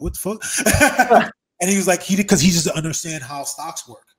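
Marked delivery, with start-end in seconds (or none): 0.0-1.3 slow, laughing disbelief; drawn-out amazed curse; a friend stunned by his stock value, laughing. Retold or imitated.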